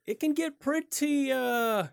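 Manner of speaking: high, drawn-out pretty crazy; exaggerated emphasis. Real, not acted. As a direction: stretch it up in pitch.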